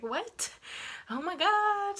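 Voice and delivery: mock panic as it hits her it's time; freaking out. Told it's time to have the baby, a shocked high cry. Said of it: "So I started freaking out".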